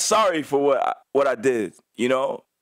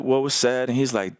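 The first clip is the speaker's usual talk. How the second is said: normal talking voice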